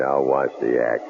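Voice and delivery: ordinary delivery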